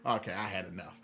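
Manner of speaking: low, soft retold surrender; resigned. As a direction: drop it low, as if giving up. Retelling giving up on show.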